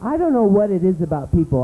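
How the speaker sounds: ordinary talk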